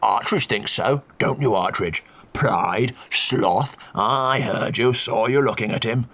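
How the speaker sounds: put-on slow, sneering old Duke; mocking counter-accusation. A narrator's reading. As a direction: turn the blame on the priest.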